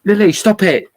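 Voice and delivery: loud, high, sudden shout of stop; sharp yell. Real, not acted. Owner yelling at the dog Lily to stop, a sharp shout. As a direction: snap her name out to stop her at once.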